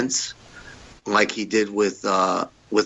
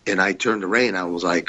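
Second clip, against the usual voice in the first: usual speaking voice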